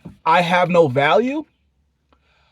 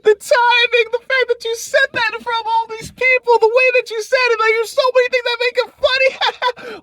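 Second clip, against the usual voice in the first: excited laughing praise. Acted. Reenacting laughing delight at joke.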